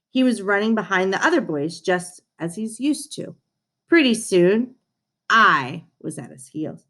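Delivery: ordinary delivery, nothing marked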